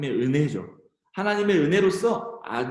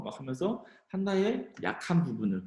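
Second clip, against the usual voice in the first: normal talking voice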